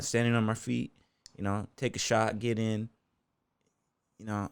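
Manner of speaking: ordinary delivery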